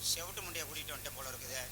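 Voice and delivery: normal talking voice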